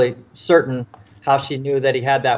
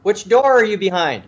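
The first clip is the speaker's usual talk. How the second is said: high, raised retold call; officer calling out to locate someone; yelling; 7 semitones higher than usual. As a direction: shout the question down the hallway. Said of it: "he yells down to him".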